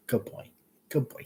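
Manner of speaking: soft, hushed praise for the dog; gentle dog praise. Real, not acted. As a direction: coo it quietly to the pet.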